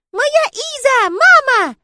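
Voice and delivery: high, loud, drawn-out wail; child's cry. Acted. Sonya crying for her Iza, high childish screaming. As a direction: scream for mama as a little girl. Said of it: "услышала я крик Сонечки".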